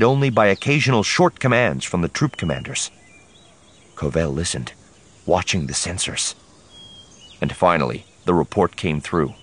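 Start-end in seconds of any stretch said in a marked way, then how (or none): none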